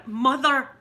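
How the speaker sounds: loud exasperated call of Mother; kids calling Mother. Retold or imitated. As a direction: call out Mother like a fed-up kid. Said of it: "I get the mother".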